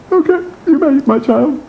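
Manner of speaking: high, mock-grand guru voice; lofty holy man. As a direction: bless her like a pompous guru. Holiness answers disciple loftily.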